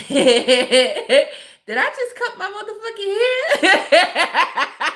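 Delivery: high-pitched, laughing mock shock; retold panic dissolving into laughter; laughing disbelief. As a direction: laugh through the quoted question. Laughing while quoting own shocked reaction.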